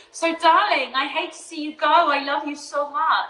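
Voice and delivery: high, tender lovey-dovey voice; sweet nothings. Acted. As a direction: coo it like a doting lover. Affectionate goodbye to co-host.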